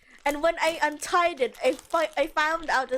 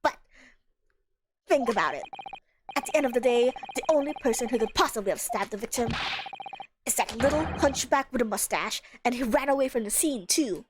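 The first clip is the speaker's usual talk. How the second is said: lower, blunt, gruff man; low gruff juror; 4 semitones lower than usual. Acted. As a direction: growl it out like a stubborn juror. Voices juror character.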